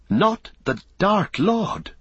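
high, slow cry of terror; terrified, drawn-out question; Pippin. A narrator's reading. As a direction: cry out in dread, pitch soaring. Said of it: "cried Pippin".

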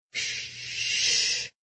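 very soft hushing; whisper. A narrator's reading. Inej silencing the guard at knifepoint, whispering. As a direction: breathe a shush right into his ear. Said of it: "she whispered in Van Dahl's ear".

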